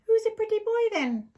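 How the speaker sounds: high, loud parrot squawk; mock parrot calling out; squawky parrot voice. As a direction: play the puppet parrot, high and bright.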